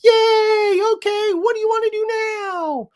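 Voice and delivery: very high, squeaky puppy voice. Retold or imitated. Owner voicing her puppy switching to happy play, high squeaky. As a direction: voice the puppy as bouncy and eager. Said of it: "she was like".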